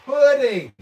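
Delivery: "pudding" blurted high and loud; loud, high, baffled "pudding"; incredulous. Real, not acted. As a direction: yelp the word "pudding" in disbelief. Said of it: "Yorkshire is pronounced".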